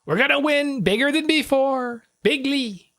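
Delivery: high, exaggerated campaign bragging; mock presidential boast. Parodying the winning promise, mock boastful. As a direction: pitch it high like a hammed-up rally boast.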